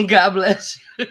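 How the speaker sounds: laughing blessing through giggles; amused God bless you, laughing. Real, not acted. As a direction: bless them while cracking up. Laughing at the child's cough.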